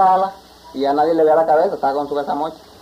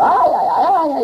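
hammed-up cries of ay, much higher; a storyteller's pitched-up mock wail; mock yelling; 10 semitones higher than usual. Tiger tied up as the wind hits, mock yelling. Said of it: "y conejo y tigre gritando".

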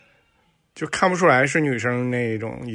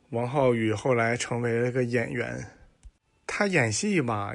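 ordinary talk, nothing marked

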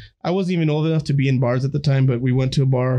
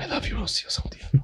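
soft whisper to wife; 2 semitones higher, 8 dB quieter than usual. Real, not acted. Shout-out to his wife.

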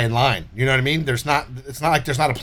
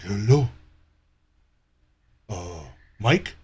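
lightly put-on European accent, lower; a hint of a foreign European caller asking for Mike; mock European-accented scammer; 6 semitones lower, slower than usual. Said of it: "weird European foreign fucking voice".

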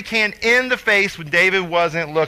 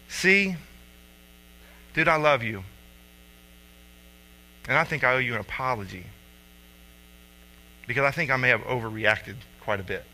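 softer, lower, gentle; tender apology; 7 semitones lower, 7 dB quieter than usual. Retold or imitated. Dad sitting on his son's bed apologising, tender. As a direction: apologise gently to a hurt child.

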